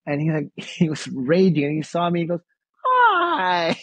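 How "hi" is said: very high, sudden change of voice; underplaying it. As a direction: imitate Dad's switch from rage to a high-pitched hello. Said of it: "he was underplaying what was going on".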